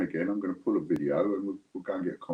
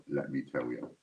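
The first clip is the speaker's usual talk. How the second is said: usual speaking voice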